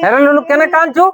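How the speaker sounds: loud, high-pitched question; calling out. Acted. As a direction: call to a crying child, high and loud. Calls out to a crying child. (চড়া গলায় ডেকে জিজ্ঞেস)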